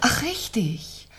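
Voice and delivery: slow, breathless whispered recall; sudden whisper. Acted. Widow remembering the parking stop, whispered slowly. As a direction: let it slip out in a hush, slowly.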